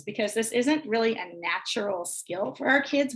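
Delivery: ordinary talk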